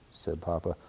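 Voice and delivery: ordinary delivery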